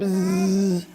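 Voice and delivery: long buzzing z sound; bee buzz. A narrator's reading. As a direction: hum a low bee buzz. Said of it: "And the bee said".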